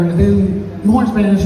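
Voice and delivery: normal talking voice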